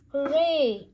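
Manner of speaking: loud, high hooray; cheering. A narrator's reading. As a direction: cheer with joy. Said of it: "cheered the crowd".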